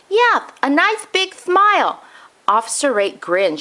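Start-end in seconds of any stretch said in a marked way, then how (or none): 0.1-2.0 put-on high old man's voice, grave and upset; a grandfather announcing a tragedy; distressed grandpa. Grandpa insisting.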